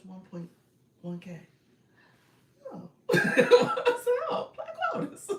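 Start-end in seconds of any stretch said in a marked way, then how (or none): 3.1-5.3 laughing greeting. Real, not acted. Caught tapping, bursts out laughing.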